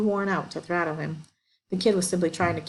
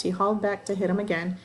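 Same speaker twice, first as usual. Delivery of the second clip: ordinary delivery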